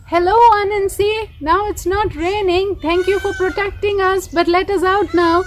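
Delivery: high-pitched pleading hornet voices. Acted. Trapped hornets asking Anansi to be let out, high and pleading. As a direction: beg sweetly to be let out.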